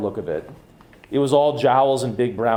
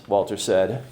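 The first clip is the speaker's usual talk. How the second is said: plain reading voice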